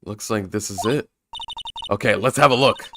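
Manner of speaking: ordinary delivery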